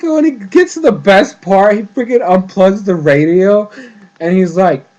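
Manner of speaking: ordinary talk, nothing marked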